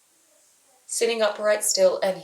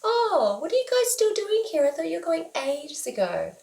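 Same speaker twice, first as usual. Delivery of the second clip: much higher, airy, casually surprised voice; breezy housemate; 9 semitones higher than usual. Retold or imitated. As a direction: float in, clueless, pitched way up. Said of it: "casually wanders out ... and says".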